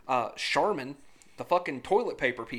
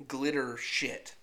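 deadpan repeat; 5 semitones lower, 6 dB quieter, much slower than usual. Real, not acted. Gasp then deadpan repeat.

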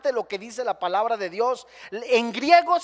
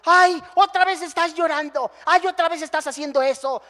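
exasperated mock sigh, higher and louder; mocking husband; 6 semitones higher, 5 dB louder than usual. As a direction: sneer at her tears. Husband mocking crying wife.

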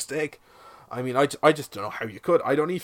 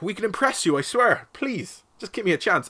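pleading; 6 semitones higher than usual. Pretend. Begging listeners for another chance.

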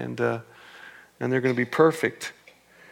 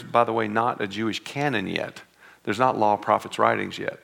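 usual speaking voice

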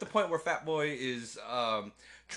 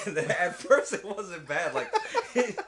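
laughing while talking; 6 semitones higher, 6 dB louder than usual. Real, not acted. Host explains his spilled drink, laughing hard. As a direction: struggle to tell it through real laughs.

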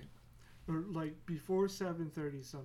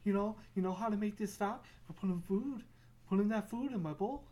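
higher, louder mocking cat; hammed-up cat taunt; 4 semitones higher, 3 dB louder than usual. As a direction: taunt him over the scratching. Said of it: "Be like".

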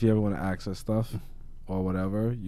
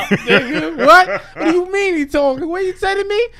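high, loud hammed-up fury; mock outrage; 11 dB louder than usual. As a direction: play a furious president. Mock president ordering strike.

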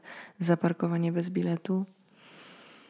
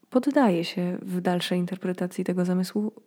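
normal talking voice

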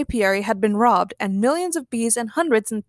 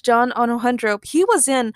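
usual speaking voice

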